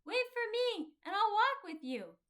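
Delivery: loud, high call across a distance. A narrator's reading. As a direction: shout ahead so he'll stop and wait. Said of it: "and he called out".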